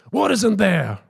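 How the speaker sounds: high, sudden demanding yell; retold yell. Guru suddenly squeezing a priest's chest, retold yell. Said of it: "and he yells".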